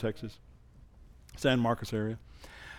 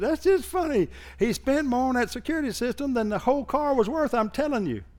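[name]'s voice; 9 semitones higher, 3 dB quieter than usual. Retold or imitated.

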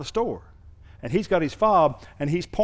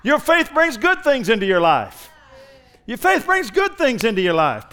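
higher, louder, fervent repetition of "Your faith brings good things into your life"; preaching at full force; 8 semitones higher, 5 dB louder than usual. Acted. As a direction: proclaim it to the congregation. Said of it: "repeated at full force".

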